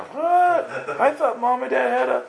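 high, shocked "What?"; a kid stunned by the news; confused child. Retold or imitated. Preacher voices confused child Eli, very high and shocked. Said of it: "Eli's very confused now".

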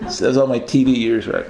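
ordinary delivery

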